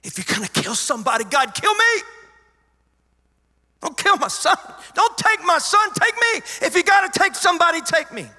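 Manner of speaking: desperate full-force yell, pitched very high; anguished, very loud bargaining cry; screaming at God. Retold or imitated. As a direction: scream it as a plea to trade places. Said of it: "ever yelled at God. I'm screaming".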